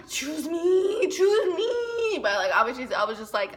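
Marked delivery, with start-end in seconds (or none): 0.1-2.2 pleading inner cry. Retold or imitated. Girl silently begging her crush to pick her. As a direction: beg him in your head, dramatically. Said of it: "inside my heart, I was just, like, crying".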